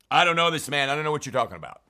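angry denial. Retold or imitated. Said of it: "call curses down on himself".

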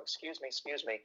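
softly voiced woman squeezing past; imitated woman. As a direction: murmur a polite, hushed apology as a woman. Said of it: "definitely a woman, going".

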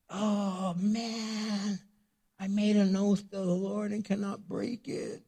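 a lightly acted father in dismay; father realizing his oath binds him, mildly panicked; freaking out. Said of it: "freaking out".

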